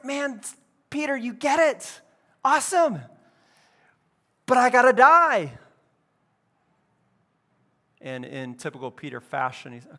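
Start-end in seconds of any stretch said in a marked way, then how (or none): 0.0-3.0 high-pitched pleased praise; delighted approval. Acted. Jesus praises Peter for getting it, delighted approval. 4.5-5.5 loud, raised-pitch declaration; emphatic announcement. Acted. Jesus tells Peter what must happen, emphatic announcement. Jesus breaks the news, acted.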